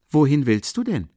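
high, loud question; insistent call. A narrator's reading. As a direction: call after someone getting up to leave.

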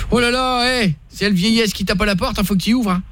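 put-on thick country accent, teasing; local French accent. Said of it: "je reprends l'accent normal".

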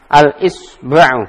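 teacher's slow clear model of Al-Isba'u; slow model reading. Shown as a demonstration. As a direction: read Al-Isba'u slowly for the students to copy. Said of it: "teacher models the word".

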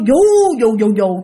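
imitated hard swinging. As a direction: mimic a hammock being swung harder. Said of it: "là nó lại càng đưa mạnh hơn".

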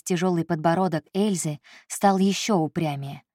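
usual speaking voice

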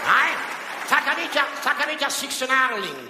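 pompous joy at the name; Sten Broman voice. Retold or imitated. Broman voice exclaiming delight.

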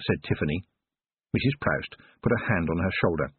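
plain reading voice

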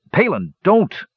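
high, wild plea to stop; frantic high-pitched protest. A narrator's reading. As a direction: cry out in alarm, pitched high. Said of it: "Sturm began wildly".

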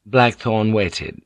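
usual speaking voice

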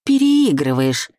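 doomed, groaning complaint; resigned groan. A narrator's reading. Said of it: "простонала я обречённо". (обречённый стон)

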